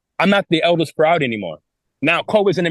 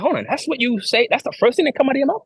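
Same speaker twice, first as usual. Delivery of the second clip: higher and quicker, incredulous; indignant disbelief; 7 semitones higher than usual. Retold or imitated. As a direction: call out the absurdity. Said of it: "I'm like".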